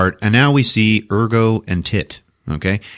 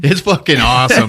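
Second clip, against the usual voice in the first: ordinary talk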